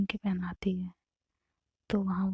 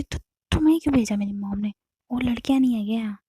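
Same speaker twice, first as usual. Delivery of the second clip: louder, fast annoyed questioning; irritated retort; 3 semitones higher, 7 dB louder, faster than usual. Acted. Navya irritated at Aarti for coming, fast loud snap. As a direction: snap at her for being sent.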